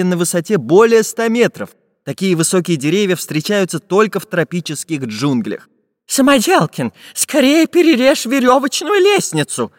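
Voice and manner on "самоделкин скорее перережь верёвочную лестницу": high-pitched frantic plea; urgent pleading. A narrator's reading. Professor begging Samodelkin to cut the ladder, pleading. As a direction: beg him to hurry. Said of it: "взмолился профессор Пыхтелкин".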